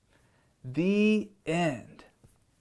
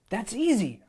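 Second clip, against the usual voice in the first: put-on boy voice; 6 semitones higher than usual. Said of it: "I said".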